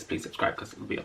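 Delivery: breathy whisper of please subscribe. Shown as a demonstration. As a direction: slip it in as a secret aside. Repeats outro request in whisper.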